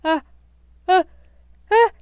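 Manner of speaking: breaking into rising ah ah; building sneeze. A narrator's reading. Sneezy offering cocoa, about to sneeze. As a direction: fight off a sneeze. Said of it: "watched Sneezy".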